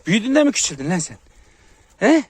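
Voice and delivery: high, loud teasing of a pup; mock scolding. Real, not acted. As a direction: take the cocky pup to task.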